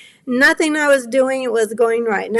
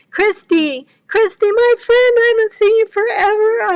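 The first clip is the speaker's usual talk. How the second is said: delighted greeting; 11 semitones higher, 5 dB louder than usual. Real, not acted. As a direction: squeal her name with joy. Greeting an old friend warmly.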